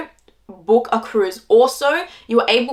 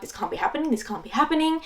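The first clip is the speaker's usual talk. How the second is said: hushed mock panic, rising on the repeat; quiet retold stress, second time higher; 3 semitones higher, 8 dB quieter, faster than usual. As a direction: softly imitate stressed disbelief. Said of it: "And I was so stressed".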